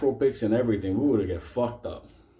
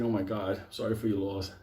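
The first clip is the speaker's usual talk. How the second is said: soft, lower imitation of a mourner; mock condolences; 4 semitones lower, 6 dB quieter than usual. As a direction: murmur insincere sympathy down the line. Benito posing as condolence-giver at casket.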